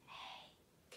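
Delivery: very soft unvoiced whisper. Shown as a demonstration. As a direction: whisper the sound to the class. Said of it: "whisper it?".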